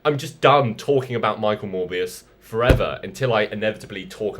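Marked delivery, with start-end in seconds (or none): 0.0-3.0 loud, high exasperated announcement; raised voice, patience gone; fed-up outburst. Acted. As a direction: call it quits loudly, completely fed up. Fed up, abruptly ending video.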